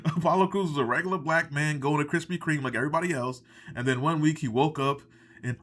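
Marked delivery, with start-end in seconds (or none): none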